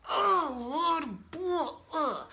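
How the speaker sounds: high, groaning exasperation acted out; pissed off. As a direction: let the retold frustration burst out. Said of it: "But I was pissed".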